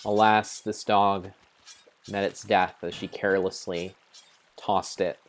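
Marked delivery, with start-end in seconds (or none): none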